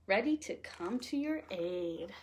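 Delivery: plain reading voice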